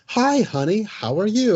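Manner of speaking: high, sweet motherly greeting; lightly sung-out mom hello. Retold or imitated. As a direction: chirp it like a doting mom. Acted mom greeting in a film.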